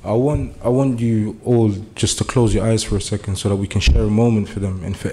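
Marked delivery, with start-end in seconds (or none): none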